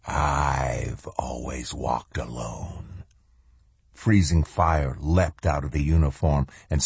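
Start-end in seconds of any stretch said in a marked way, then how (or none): 0.1-3.0 slow, low, soft ancient being. A narrator's reading. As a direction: let it rumble slow and quiet from ages past. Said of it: "the ancient voice answered".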